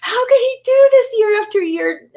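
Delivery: very high rock-singer squeal; mock screech of disbelief; cheese-grater screech. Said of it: "taking a cheese grater to his vocal cords".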